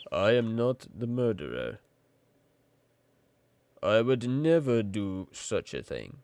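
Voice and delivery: very slow, deliberate character voice; Woodman's Lee. Pretend. Woodman's Lee denies being the murderer, slow deliberate voice. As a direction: draw each word out in solemn denial.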